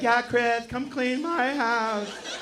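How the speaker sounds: high, slow, drawn-out voice; put-on high woman's voice, dragging the words; mocking lady heckler. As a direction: stretch it out in a high mock-supportive lady voice. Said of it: "She's like".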